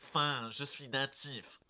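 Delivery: slow and soft, nose pinched; pinched-nose French. Pretend. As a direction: say it like a villager with a plugged nose. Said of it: "plug your nose so you sound native".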